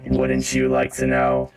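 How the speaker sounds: deep, slow gloating villain; robot villain taunt. Acted. The robot repeats his taunt to the player, deep and gloating. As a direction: sneer it down from above.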